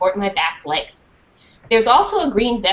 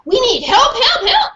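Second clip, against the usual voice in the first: desperate plea; 13 semitones higher, 4 dB louder than usual. Acted. Dog begging.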